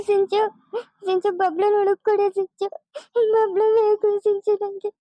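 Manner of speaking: distraught mother weeping between phrases; crying. Acted. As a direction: beg through sobs for your missing child. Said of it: "ಅಳ್ಬೇಡ ಸುಮ್ನಿರಕ್ಕ".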